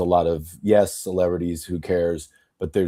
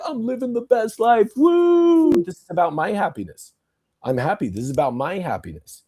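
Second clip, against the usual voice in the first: mock party boaster; 10 semitones higher than usual. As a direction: ham up a gloating divorcé. Mocking divorced man's online boast.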